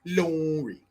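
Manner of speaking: "Lorry" drawn out, mock British; mock British accent. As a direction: relish the word in a British accent. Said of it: "It's just funny to say".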